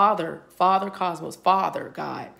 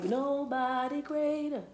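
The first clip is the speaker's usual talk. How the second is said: drawn-out high; 8 semitones higher, 5 dB quieter, slower than usual. Acted. Dramatic emphasis in a spiritual message.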